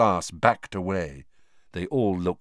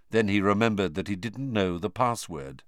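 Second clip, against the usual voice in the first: plain reading voice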